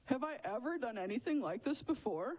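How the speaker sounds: protesting donkey. Acted. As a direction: argue back as a hurt talking donkey. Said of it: "You know what the donkey says back?".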